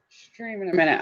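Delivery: ordinary delivery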